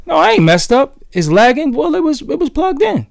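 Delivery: high-pitched, incredulous protest; puzzled protest. Real, not acted. Streamer disputing a lag warning on the stream, high baffled protest.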